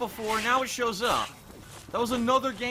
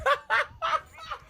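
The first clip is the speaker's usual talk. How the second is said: a very high burst of laughter; high cackle. Real, not acted. Gamer laughing at his own joke, high cackle.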